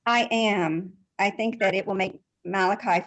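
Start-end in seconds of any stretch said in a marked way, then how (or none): none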